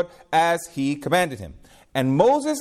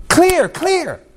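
emphatic; 12 semitones higher than usual. Real, not acted. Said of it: "preacher's emphatic repeat".

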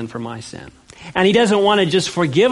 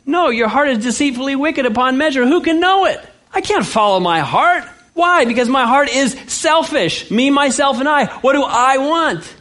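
fiery pulpit rebuttal, much higher; preaching at full force; 9 semitones higher than usual. Real, not acted. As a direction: hammer the point home, voice raised high.

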